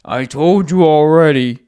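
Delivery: slow, loud and high-pitched; mock shout. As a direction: yell it back, stretched out. Joke bit, shouting back.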